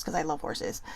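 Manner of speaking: a quick breathy aside under the breath; whispered side comment. Real, not acted. Quick aside about loving horses.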